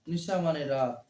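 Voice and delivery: ordinary talk, nothing marked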